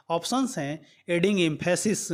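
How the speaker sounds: ordinary talk, nothing marked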